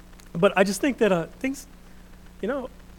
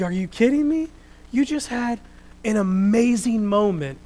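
high, exasperated disbelief; raised-pitch, emphatic astonishment; mock incredulity; 5 semitones higher, 6 dB louder than usual. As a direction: react as if you can't believe it. His own incredulous reaction to Elijah.

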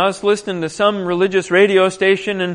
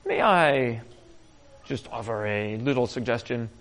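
much softer and lower, hesitant; hushed, deferential servant voice; timid servant; 8 semitones lower, 11 dB quieter than usual. Retold or imitated. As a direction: politely tiptoe a suggestion to the master. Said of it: "one of his cooler-headed servants says".

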